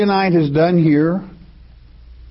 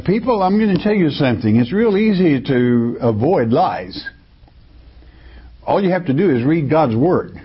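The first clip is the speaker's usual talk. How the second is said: normal talking voice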